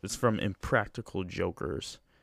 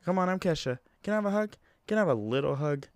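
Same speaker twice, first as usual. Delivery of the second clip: imitating [name]; 7 semitones higher, much faster than usual. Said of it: "was like".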